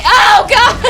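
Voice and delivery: startled scream into a laugh; loud sharp voice. Real, not acted. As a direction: scream then burst out laughing. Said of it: "Why are you screaming?".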